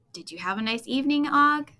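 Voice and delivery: ordinary delivery, nothing marked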